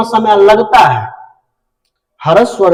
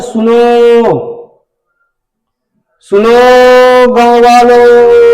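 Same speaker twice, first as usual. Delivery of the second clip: slow, loud drawn-out call to the villagers; village crier call; 3 semitones higher, 4 dB louder, much slower than usual. Shown as a demonstration. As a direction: call out across the village, stretching every word. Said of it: "जैसे हम कहते हैं".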